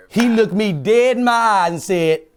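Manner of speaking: ordinary delivery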